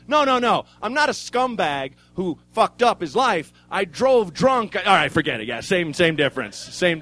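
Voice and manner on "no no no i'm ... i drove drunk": defensive protest. Retold or imitated. Protesting to the neighbors, loud and defensive. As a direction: insist you're not a scumbag.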